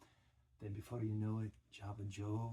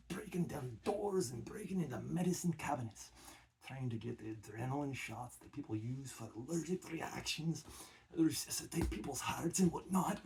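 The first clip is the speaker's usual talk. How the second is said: heavy Scottish accent, mock-serious; a Scot warning about caffeine, Scottish accent. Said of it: "working on my Scottish accent".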